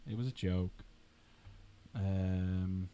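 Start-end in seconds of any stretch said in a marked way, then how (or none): none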